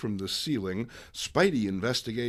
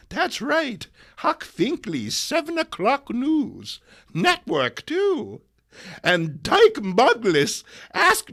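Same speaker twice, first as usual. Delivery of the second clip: put-on excited old lady; 7 semitones higher, 6 dB louder than usual. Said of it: "the excited old lady".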